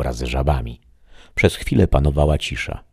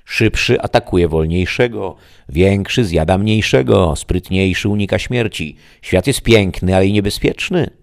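higher, louder solemn lesson; the voice lecturing; 6 semitones higher, 6 dB louder than usual. Acted. The voice lectures the shadow, higher and louder. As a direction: recite the law of the wild.

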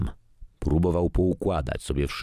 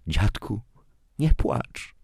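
put-on higher, childlike consoling voice; little girl; 6 semitones higher, slower than usual. A narrator's reading. Granddaughter comforts her weeping grandfather, childlike and consoling.

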